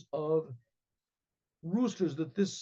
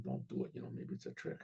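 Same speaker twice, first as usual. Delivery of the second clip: whispered, much softer warning; hushed warning; 4 semitones lower, 11 dB quieter, faster than usual. Acted. Advisor secretly warning the king, whispered caution.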